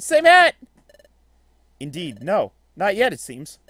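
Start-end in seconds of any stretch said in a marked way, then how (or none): 0.0-0.6 high, louder same hat; louder higher repeat. Shown as a demonstration. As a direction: push it up high and loud.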